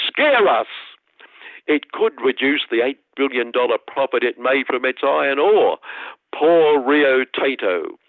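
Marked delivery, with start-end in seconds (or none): 0.1-0.7 lightly voiced boss, higher, with a hint of fright; mock-fearful whimper; mock scared boss. Quoting the Rio Tinto chief's complaint in a mock fearful voice. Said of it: "said the business". 6.4-8.0 mock pity.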